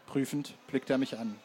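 plain reading voice

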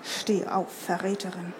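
quietly threatening archer, cold as bone; cold bony voice. A narrator's reading. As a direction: hiss it like dry bones. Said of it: "mit kalter, knochiger Stimme".

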